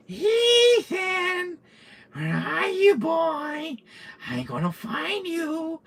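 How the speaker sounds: high, drawn-out taunting call; mock villain calling for his prey. Villain calling out to hiding player.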